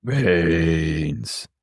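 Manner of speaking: slow drawn-out zombie moan. Acted. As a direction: groan the word like the undead. Zombie host serving dinner.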